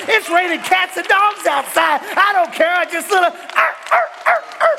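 rising shouted sermon climax; full-force preaching. Acted. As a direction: build the congregation up, roaring.

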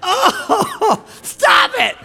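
high, light laughing voice; giggling. Real, not acted.

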